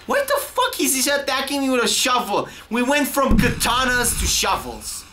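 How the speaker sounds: high-pitched incredulous outburst; outraged disbelief. Real, not acted. Gamer to the game on being hit with a shovel, outraged.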